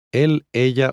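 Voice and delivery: ordinary talk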